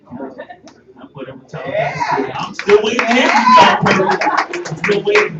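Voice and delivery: high, loud, fed-up call-out; loud frustrated rant about waiting; exasperated shout. Real, not acted. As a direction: shout it out to the world, still waiting. Exasperated about unanswered application.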